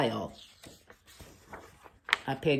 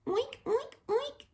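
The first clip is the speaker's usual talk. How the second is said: very high squeaky oinks; tiny piglet squeaks; baby pig; slower than usual. Acted.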